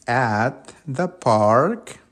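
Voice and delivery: very slow, word by word, stress on "At"; slow teacher model of the right phrase; correct answer, slow. Shown as a demonstration. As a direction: set it right for the class slowly. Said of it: "¿Cómo es entonces?".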